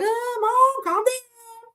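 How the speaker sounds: high, put-on little boy voice; imitating her son. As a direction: play the son soothing his mom. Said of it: "and he's like".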